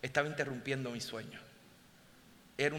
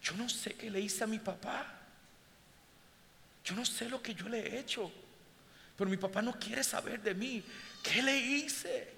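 retold tearful plea; 7 semitones higher than usual. Woman on plane telling about her father, imitated crying. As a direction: mimic the daughter's broken plea, rising to a thin wail. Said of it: "ella comienza a llorar y me dice".

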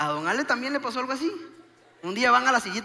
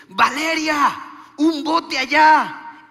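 loud, raised call across the beach; 6 semitones higher, 10 dB louder, much slower than usual. Retold or imitated. Husband spotting a boat for his wife, acted calling out. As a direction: call it out high and drawn out.

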